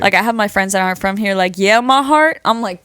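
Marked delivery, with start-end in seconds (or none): 1.6-2.4 louder, raised mock of outsiders' slang; mocking imitation. Imitating non-New York friends' misused lingo, mocking. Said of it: "they either misuse it or make fun of it".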